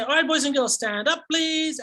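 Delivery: put-on rally the kids to stand up; upbeat teacher calling the class; classroom teacher voice. Shown as a demonstration. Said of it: "So I'll say".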